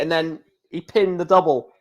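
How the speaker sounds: normal talking voice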